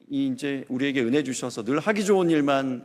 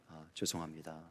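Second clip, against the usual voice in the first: soft, lower and quieter courteous apology; humbly smooth it over with the client; gently deferential sorry; polite apology; 7 semitones lower, 13 dB quieter than usual. Retold or imitated. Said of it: "정중하게".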